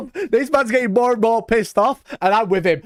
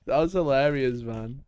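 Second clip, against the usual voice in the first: ordinary delivery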